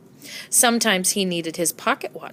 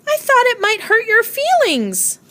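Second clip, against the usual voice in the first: neighbor's voice; 14 semitones higher, 4 dB louder than usual. A narrator's reading.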